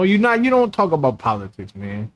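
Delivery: usual speaking voice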